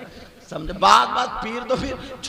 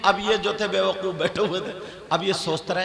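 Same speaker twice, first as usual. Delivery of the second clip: normal talking voice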